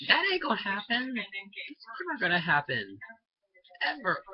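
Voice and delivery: high-pitched, emphatic refusal; indignant denial. Real, not acted. Ranter rebutting the absurd dinner idea, indignant denial. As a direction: shoot the idea down, voice jumping up. Rebutting the imagined parent's acceptance.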